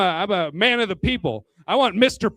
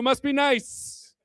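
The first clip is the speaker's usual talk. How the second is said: slow, dry "must be nice"; mock envy, slowed right down; sarcastic drawl; 4 semitones higher, much slower than usual. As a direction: drag it out with contempt. Running sarcastic catchphrase.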